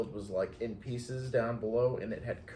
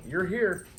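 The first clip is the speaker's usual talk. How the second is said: higher and louder, rising then falling; mock arrival announcement; 7 semitones higher, 6 dB louder than usual. Retelling being dropped on a dirt road, mock announcement. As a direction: announce arrival with exaggerated certainty.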